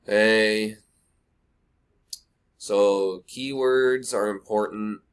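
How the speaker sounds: slow, drawn-out monotone with long pauses; mock a dull speaker, dragging out every word; hammed-up sluggish video host; imitating boring presenter. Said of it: "don't try to be like someone that's like".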